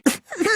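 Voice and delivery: short high nervous giggle; a caught-out titter, pitched high. Acted. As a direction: laugh it off badly. Nervous laugh when accused.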